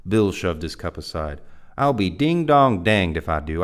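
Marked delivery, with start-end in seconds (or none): 1.8-3.6 loud, stubborn outburst; stubborn loud refusal. Acted. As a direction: shout the refusal, dug in.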